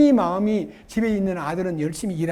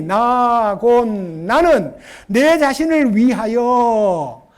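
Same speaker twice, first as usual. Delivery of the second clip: each word drawn out, loud and weighty; solemn proclamation; slow emphatic scripture reading; 7 dB louder, much slower than usual. Acted. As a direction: proclaim it like holy writ.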